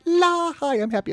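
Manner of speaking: high, loud burst of mock glee; voicing a delighted face. Editor voicing his friend's happy photo, high gleeful greeting. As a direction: burst out high, then bubble down through the words. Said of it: "Her face is just like".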